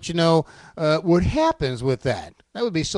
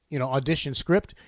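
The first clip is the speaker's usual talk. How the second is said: normal talking voice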